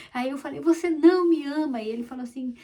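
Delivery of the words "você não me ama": put-on higher, louder complaint of a hurt child; mock childish protest to her father; sulky little girl. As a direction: whine it like a small girl who feels unloved. Little girl voiced in story.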